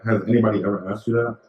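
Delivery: usual speaking voice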